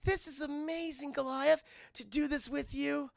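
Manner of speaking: high, awestruck imitation; Elisa Maza. Elisa gliding with Goliath, acted wonder. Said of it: "And she's like".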